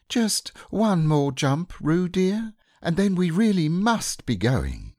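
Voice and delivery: high, gentle mother kangaroo voice; high motherly Kanga. A narrator's reading. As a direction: coax like a fond mother kangaroo.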